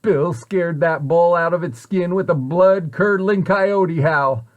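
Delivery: ordinary delivery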